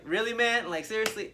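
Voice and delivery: high, loud incredulous retort; exasperated. Retold or imitated. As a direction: throw it back in disbelief. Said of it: "And then I'm like".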